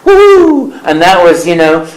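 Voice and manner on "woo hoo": much higher, loud whooping cheer; jubilant cheer. Retold or imitated. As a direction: whoop with joy at the news. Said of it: "and I quote".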